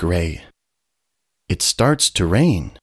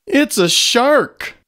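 1 alarmed cry; 4 dB louder than usual. A narrator's reading. Skunk cries shark alarm.

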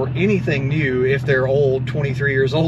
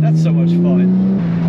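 excited; 3 semitones higher, 6 dB louder, much slower than usual. Real, not acted. After emergency stop, thrilled.

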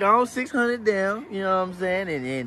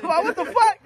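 retold shock; 9 semitones higher than usual. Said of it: "Dustin said".

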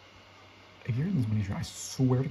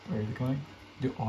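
usual speaking voice

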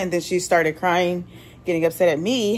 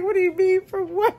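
incredulous; 11 semitones higher than usual. Retold or imitated.

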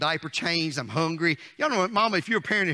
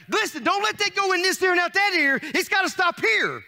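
high, loud prophet-preacher cry; preaching like John the Baptist; 9 semitones higher, 3 dB louder than usual. Acted. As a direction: warn the church as the forerunner. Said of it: "I'm being like John the Baptist today".

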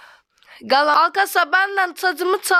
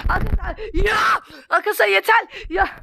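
upset shout; 6 semitones higher, faster than usual. Real, not acted. Crashing repeatedly, upset.

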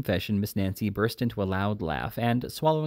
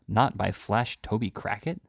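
ordinary delivery, nothing marked

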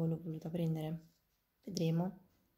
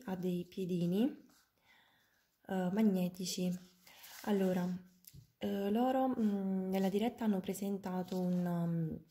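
ordinary delivery

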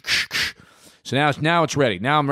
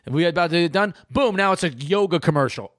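ordinary delivery

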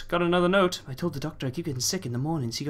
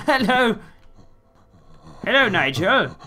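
high, loud mock cheery greeting; silly greeting voice; 6 semitones higher, 4 dB louder than usual. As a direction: ham up a friendly hello. Greets the creature mockingly.

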